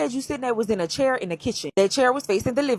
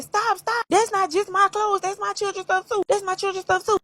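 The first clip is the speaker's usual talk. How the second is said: much higher, pleading mock scream; retold screaming; 10 semitones higher than usual. Woman begging him to stop bleaching her children's clothes, mock screaming. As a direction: imitate her desperate cries without the volume. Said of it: "you hear her screaming".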